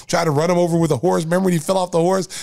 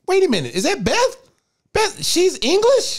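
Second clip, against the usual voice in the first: retold disbelief. Host retelling finding out Beth is English, high mock disbelief. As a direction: act out a stunned double take, voice shooting up. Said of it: "I'm like".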